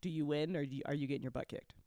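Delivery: ordinary talk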